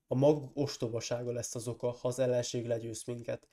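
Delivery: ordinary delivery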